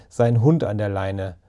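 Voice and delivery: ordinary delivery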